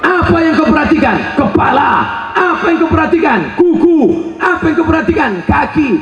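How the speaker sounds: loud, high-pitched rapid-fire questions; preacher hammering his questions, high and loud; forceful preaching. Real, not acted.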